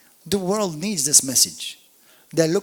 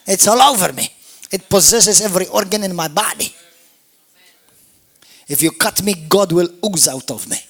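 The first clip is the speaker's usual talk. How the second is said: louder, higher, building fervor; rising preaching; 4 semitones higher, 7 dB louder than usual. Real, not acted. Pastor insisting to the church, growing loud. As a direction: build it up, full of fire.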